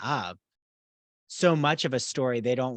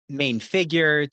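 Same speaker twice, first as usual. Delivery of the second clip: ordinary talk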